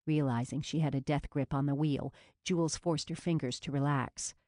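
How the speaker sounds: usual speaking voice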